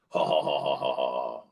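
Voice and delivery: low, put-on bro laugh, ha ha ha; lightly voiced guys' guffaw; mocking laugh of the guys; mock dumb laugh.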